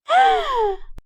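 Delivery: high shocked gasp; sharp gasp. A narrator's reading. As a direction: gasp hard in astonishment. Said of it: "فشهقت بقوة". (شهقة قوية)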